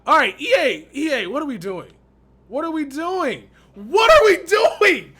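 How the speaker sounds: loud, high-pitched outburst at the game; frustrated yelling. Real, not acted. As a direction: shout at EA in disbelief. Yelling at EA over the sim.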